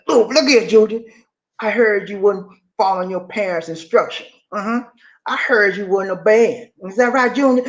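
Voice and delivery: mock menacing inmate talk; inmate lecture. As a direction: inmate scolding the boy.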